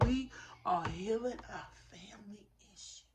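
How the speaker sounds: hushed and reverent; whispered message. Real, not acted. Telling viewers spirit's words in a reverent whisper. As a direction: whisper it like holy news.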